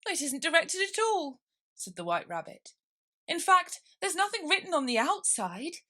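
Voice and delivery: high, flustered rabbit voice. Acted. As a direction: squeak it out in a hurry.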